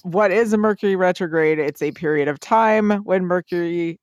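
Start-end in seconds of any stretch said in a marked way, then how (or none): none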